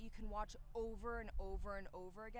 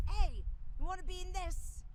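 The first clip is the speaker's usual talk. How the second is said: high, loud mock Dexter voice, no real accent; a quick, excited half-hearted Dexter impression; imitating Dexter; 12 semitones higher, 13 dB louder than usual. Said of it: "I can't do his accent".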